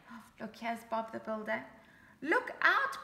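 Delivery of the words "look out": loud, high warning cry; cried out warning. Acted. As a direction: warn Roly urgently. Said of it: "Bob cried".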